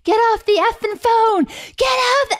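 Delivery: high mock yell of an angry child; imitating screaming kid. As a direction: shriek it like a four-year-old on a toy phone. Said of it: "he's yelling on the phone".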